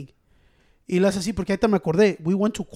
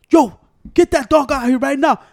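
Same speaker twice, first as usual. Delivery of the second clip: loud, higher barking order; bossy cop; 5 semitones higher, 9 dB louder than usual. Retold or imitated. Retelling the cop at the door, a loud bossy order. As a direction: bark it like a rude cop at the door. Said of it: "He was a dick too".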